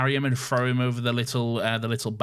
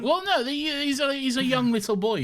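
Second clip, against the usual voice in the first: high, mock-cute little voice; babyish cooing; 14 semitones higher than usual. Co-host defending the young boy, high babyish voice. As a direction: coo it high as if about a tiny child.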